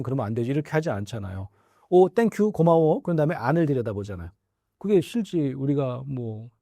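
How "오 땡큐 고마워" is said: mock cheerful thanks. Thanking the kettle lid.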